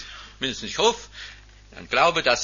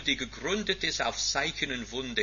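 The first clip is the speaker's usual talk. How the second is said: ordinary talk, nothing marked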